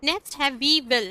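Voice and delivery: ordinary talk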